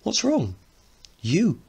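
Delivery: high, playful voices; boyish banter. Acted. The brothers trade quick high banter. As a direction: tease like young boys.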